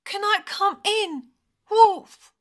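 high, pleading lamb call; little lamb crying out. A narrator's reading. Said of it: "she cried".

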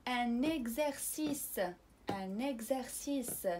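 ان اكزرسيس said very slowly with a voiced gz; French ان اكزرسيس, slow, gz clearly voiced; slow gz sound. Shown as a demonstration. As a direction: stretch اكزرسيس as "egzersis" for repeating. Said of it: "حاولوا تعاودوا معايا".

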